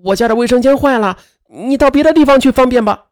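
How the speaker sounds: nervous, high-pitched deflection; flustered. A narrator's reading. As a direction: put him off in a mild fluster. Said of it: "张丽红慌乱地说".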